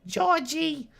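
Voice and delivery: very high-pitched sitcom mother impression; George's mom. Sitcom mother calling her son, high put-on voice. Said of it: "More like George's mom's accent".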